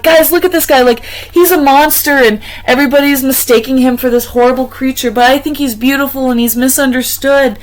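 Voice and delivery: high, loud, eager gushing; excited gushing. Retold or imitated. Telling everyone about the monster, high, loud and eager. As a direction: gush about him to the whole room.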